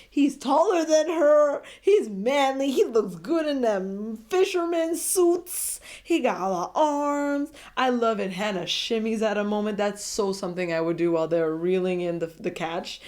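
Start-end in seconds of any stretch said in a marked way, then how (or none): 0.1-8.3 higher and louder, slower, swooning praise; excited fangirl gushing. Real, not acted. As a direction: drool over him out loud. Gushing over Tyler.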